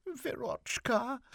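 very high, slow, plaintive call; pleading mother. A narrator's reading. Mother appealing to her silent daughter, plaintive. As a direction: draw the name out in a high plea.

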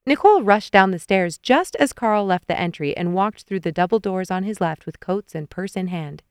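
ordinary delivery

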